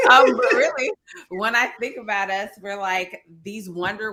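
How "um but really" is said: high laugh spilling into words. Real, not acted. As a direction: laugh at your own joke, then carry on. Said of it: "laughs at own pun".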